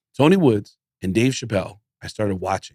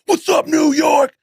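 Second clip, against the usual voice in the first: loud, high, drawn-out stage greeting; high-energy shout; 5 dB louder, slower than usual. Retold or imitated. Comic bursting onstage, greeting the crowd with a high yell. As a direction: yell it out like a hyped emcee. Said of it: "I would get up there".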